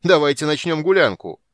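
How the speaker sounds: loud, high party whoop; rowdy shout. A narrator's reading. Sturm, dancing, loudly calls for a feast. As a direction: call everyone to carouse.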